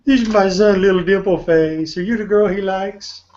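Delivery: high teasing sing-song; playful mocking voice. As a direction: tease like a doting parent.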